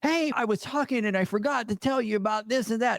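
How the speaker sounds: high, eager rushed callback; eager friend again. Retold or imitated. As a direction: mimic a friend who forgot something. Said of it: "calls me back".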